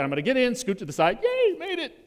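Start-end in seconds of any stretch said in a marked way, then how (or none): 1.2-2.0 high, chirpy little cheer; a hammed-up cheer at heaven's door; mock relief. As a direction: squeak it like you barely got in. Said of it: "I sometimes feel like that".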